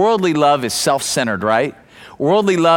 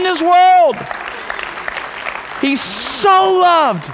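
much higher and louder, slowing on key words; preaching at full force; 11 semitones higher, 3 dB louder than usual. Acted. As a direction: preach it out, lifting the room.

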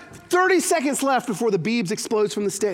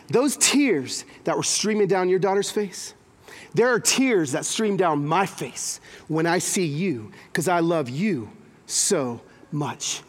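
gentle, slowed-down words of God's love; tender voice of God; 6 semitones lower, slower than usual. Acted. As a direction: speak as a loving father over his child.

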